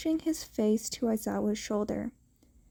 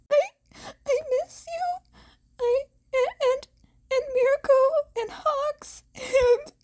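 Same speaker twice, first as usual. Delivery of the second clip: much higher, tearful stammering rush; crying; 3 dB louder than usual. Acted. Teen blurting out who he misses, crying. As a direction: blurt it through sobs.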